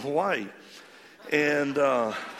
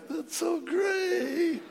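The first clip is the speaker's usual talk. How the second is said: choked up; much slower than usual. Retold or imitated. Preacher moved, retelling his reaction.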